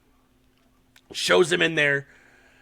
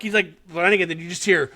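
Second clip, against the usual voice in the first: ordinary talk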